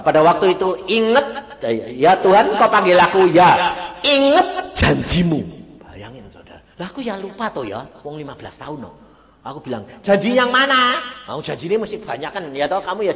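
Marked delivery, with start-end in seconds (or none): 0.0-5.5 loud, high preaching; full-force preaching. Acted. Preacher to church, loud and fervent. 10.0-11.0 high, loud surprised ask; startled question. Retold or imitated. Preacher replying to God, startled and loud.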